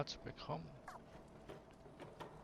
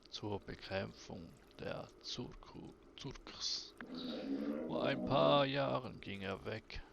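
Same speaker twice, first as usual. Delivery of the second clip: put-on lower, subdued voice telling an old sad story; somber storyteller figure; 4 semitones lower than usual. Character explaining Simus's missing father, lower and somber. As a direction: tell of the lost father, low and heavy.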